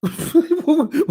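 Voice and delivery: high bright laugh bursting out; amused laugh burst. Real, not acted. Vlogger laughing at the doctor's reply, high amused laugh. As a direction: laugh at the absurd answer.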